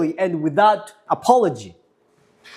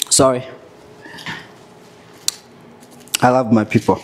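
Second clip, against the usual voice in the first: choked up; 5 semitones lower, faster than usual. Real, not acted. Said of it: "Sorry, y'all".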